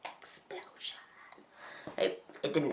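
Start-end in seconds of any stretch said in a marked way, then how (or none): 0.0-1.0 hushed, breath-only mock blast; whispered sound effect. Vlogger acts out her car crash, whispering a mock explosion. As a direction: whisper it like a tiny pretend explosion.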